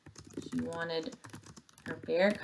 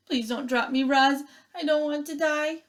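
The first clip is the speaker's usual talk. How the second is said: frightened cry; 8 semitones higher than usual. Acted. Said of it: "Thorn let go of the branch and cried".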